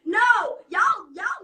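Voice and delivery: a sharp loud NO to cut in; sudden shout. Real, not acted. Frustrated podcaster shouting down critics, loud. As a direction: shout it down, full force.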